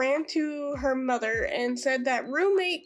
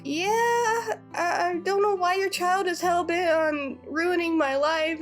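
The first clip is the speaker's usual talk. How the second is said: higher, choked-up and close to crying; a retold wounded cry of exasperation; tearful plea; 4 semitones higher than usual. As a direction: let the voice crack as the frustration spills out. Roommate upset after false accusation.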